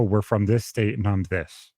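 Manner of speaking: usual speaking voice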